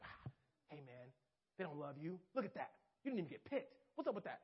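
soft, whispered devil's taunt; Satan whispering lies to a disappointed believer. Acted. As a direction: hiss doubts into their ear. Satan voiced taunting the listener.

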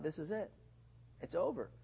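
softly, like a thought to oneself; hushed, understated realization; resigned inner thought. Retold or imitated. Boy under the truck thinks it's the end, hushed resignation. Thought when facing death in story.

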